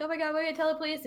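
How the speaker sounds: fast, high, hushed mock panic; mock freaking out. Sally panicking to her sister about police, mock freak-out. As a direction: rattle it off in a small, high fluster. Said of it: "like, freaking out".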